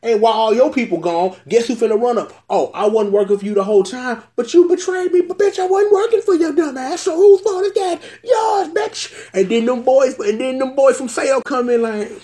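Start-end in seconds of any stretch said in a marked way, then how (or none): 2.5-9.1 high, rising taunt ending in a shouted bitch; mocking villain taunt. Bloodstalk gloating at the betrayed girl, high mocking shout. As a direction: gloat like the traitor who played everyone.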